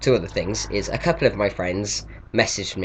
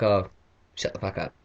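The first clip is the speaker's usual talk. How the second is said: dismissive brush-off; 4 dB quieter than usual. Retold or imitated. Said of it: "before I'd be like that".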